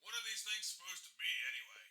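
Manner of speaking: faint, distant radio voice; over the radio. Acted.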